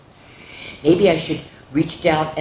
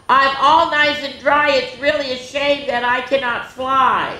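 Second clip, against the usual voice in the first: put-on child's call; 9 semitones higher than usual. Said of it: "He yelled to his papa".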